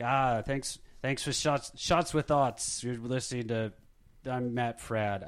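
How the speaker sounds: a loose, slow Australian accent impression. Host thanks listeners in a mock Australian accent. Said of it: "I can't do a Australian Matt Fradd accent".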